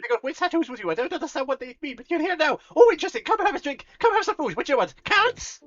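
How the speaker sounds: high, loud, gushing tavern host; excited beholder tavernkeeper. Acted. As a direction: greet them like an overjoyed innkeeper. Luigi offers food.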